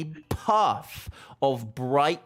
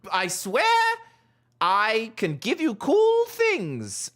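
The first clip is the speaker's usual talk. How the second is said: high, slow, desperate toad; pleading toad voice; 12 semitones higher, 4 dB louder, slower than usual. Acted. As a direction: beg them to stay, high and pleading.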